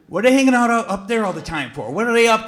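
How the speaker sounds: loud, high mock-suspicious neighbor. Dad imitating neighbors suspicious of teen boys at park, loud and high.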